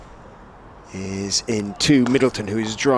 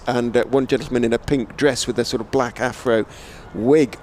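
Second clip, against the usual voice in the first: normal talking voice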